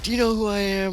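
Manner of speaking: mock angry. Retelling a soldier who gets mad, mock angry. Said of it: "then he gets mad".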